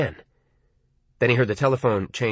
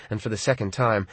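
usual speaking voice

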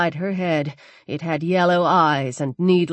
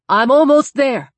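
calling out; 5 semitones higher, 6 dB louder than usual. Acted. Calling to drowning man.

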